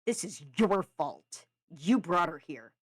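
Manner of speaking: loud, slow, heavy accusation; angry narrated character voice. A narrator's reading. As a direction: lay the blame on him, loudly.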